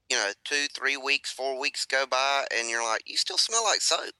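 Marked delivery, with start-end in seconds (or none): 3.0-4.1 mock surprised. Dad quoting buddy about dog.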